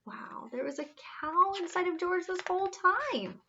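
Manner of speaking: whispered in wonder; awed whisper. A narrator's reading. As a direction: breathe it in awe.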